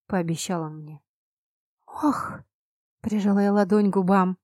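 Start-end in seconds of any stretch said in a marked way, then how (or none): 1.9-2.4 breathy gasp of shock; gasp, hand over mouth. A narrator's reading. Heroine gasps at the prince's grim promise, shocked.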